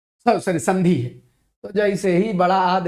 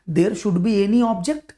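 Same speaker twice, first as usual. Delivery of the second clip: very slow spelled-out explaining; much slower than usual. Shown as a demonstration. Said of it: "slow explaining".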